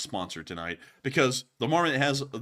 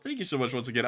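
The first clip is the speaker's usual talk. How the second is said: ordinary talk, nothing marked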